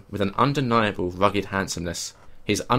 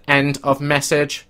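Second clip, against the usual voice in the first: slow robot voice declaring; flat robot announcing; 5 semitones higher, 5 dB louder, slower than usual. Acted. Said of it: "A robotic voice declared".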